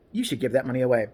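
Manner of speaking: ordinary delivery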